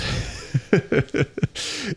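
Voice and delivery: short breathy laugh; a quick laugh at himself; kurzes Lachen. Real, not acted. Laughs about his own cold. (kurzes, hauchiges Lachen)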